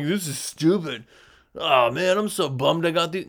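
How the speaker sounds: grumbling put-on customer voice; mock disappointed customer. Customer complaining after the job, mock disappointed.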